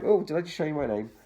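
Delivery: plain reading voice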